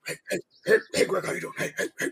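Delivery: repeated feeble heys, almost no voice; slow customer's voice. Retold or imitated. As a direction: impersonate him in a hushed, toneless croak. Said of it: "that is his voice right there".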